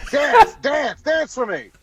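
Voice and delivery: high, loud laughing demand; mock yell. Star laughing as he demands a dance, shouting. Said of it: "he's screaming at Seth MacFarlane".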